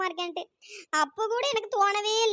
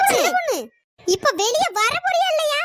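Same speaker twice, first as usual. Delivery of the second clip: high, loud call from outside the house; shouting; 5 semitones higher, 5 dB louder than usual. Acted. Angry neighbour shouting at the door, loud. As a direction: yell her out of the house. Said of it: "காட்டு கத்து கத்திட்டு கிடக்கிறது?".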